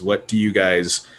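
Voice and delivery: ordinary talk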